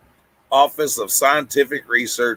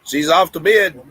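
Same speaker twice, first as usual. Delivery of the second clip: usual speaking voice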